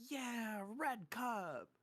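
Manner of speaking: high-pitched imitation of a cheering kid; delighted little girl. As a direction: mimic a child's excited cheer. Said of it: "The girl's like".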